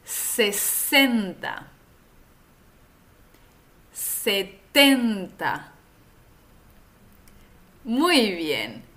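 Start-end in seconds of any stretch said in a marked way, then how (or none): 0.0-1.7 sesenta very slowly, crisp s sounds; slow model. Shown as a demonstration. Teacher models sesenta slowly for repeating. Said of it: "repite después de mí". 3.9-5.7 setenta very slowly, crisp t; slow model. Shown as a demonstration. Teacher models setenta slowly for repeating. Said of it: "repite después de mí".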